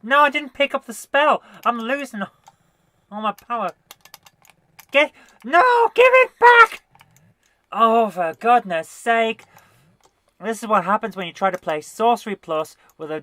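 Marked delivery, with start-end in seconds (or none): 0.0-6.8 high, loud panicked shout; frantic yell. Real, not acted. Losing power in game. 7.7-9.4 slow, loud exasperation; exasperated. Real, not acted. Annoyed at joystick.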